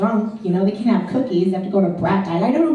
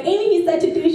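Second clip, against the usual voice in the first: mock friend; 9 semitones higher than usual. Friend's voice quoted.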